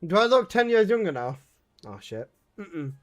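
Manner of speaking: ordinary delivery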